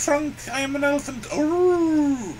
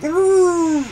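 louder roared word; spoken roar; 3 semitones higher, 6 dB louder than usual. Acted. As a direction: bellow roar playfully. Elephant roars before joke.

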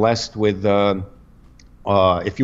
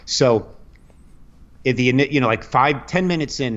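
usual speaking voice

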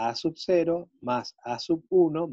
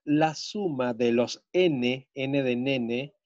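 dictating; slower than usual. Shown as a demonstration. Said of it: "teacher dictating to class".

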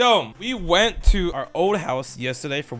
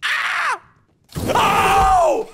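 high-pitched yelps, the second louder; startled screams; 7 dB louder than usual. Real, not acted. Streamer caught off guard, high startled screams. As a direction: scream out in fright.

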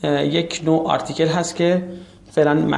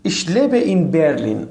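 slow model answer; much slower than usual. Shown as a demonstration. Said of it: "teacher models German answer".

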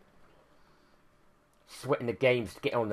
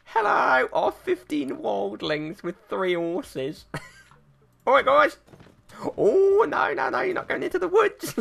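much higher, hammed-up taunting voice; mock squeaky voice; 4 dB louder, slower than usual. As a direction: squeak it out to tease the enemy. Mock high voice at enemies.

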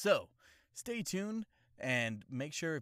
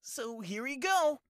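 put-on anime boy voice; 3 dB louder than usual. High hyped channel intro.

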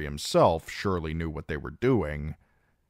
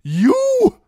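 explosive outburst, far higher and louder; furious shout; 13 dB louder than usual. A narrator's reading. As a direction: roar it at the man who insulted him. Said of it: "face twisted in sudden fury".

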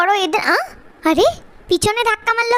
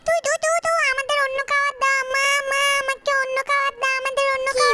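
high, small voices begging for food; tiny babies nagging for something to eat; demanding baby mice; 3 semitones higher, 5 dB quieter than usual. Acted.

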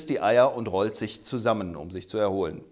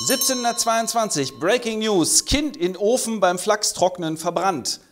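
higher, punchy newsreader announcement; hammed-up news anchor; breaking news flash; 7 semitones higher than usual. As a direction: sell it like a breaking headline. Mock news flash over music.

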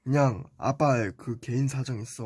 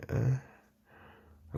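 little brother voice; 2 semitones lower, 7 dB quieter than usual. Acted. Younger Teletubby hesitates.

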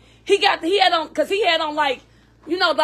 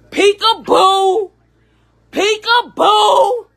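drawn-out, louder sing-song peekaboo; playful peekaboo call; 4 semitones higher, 5 dB louder, much slower than usual. Retold or imitated. Mother calling for her baby in the store, slow sing-song. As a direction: stretch each peekaboo out like calling a hiding child.

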